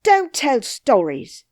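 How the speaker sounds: loud, high rude snap; rude cry. Acted. Nurse rudely cries at the princess, loud. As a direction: bark it at the girl. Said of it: "cried the nurse quite rudely".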